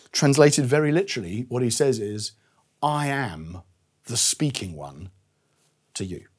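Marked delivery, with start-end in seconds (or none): 2.8-6.3 spaced out phrase by phrase, sinking low and soft; slow, weighty quotation. Shown as a demonstration. Preacher translating Jesus's words very literally, slow and weighty. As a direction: let each phrase drop into silence.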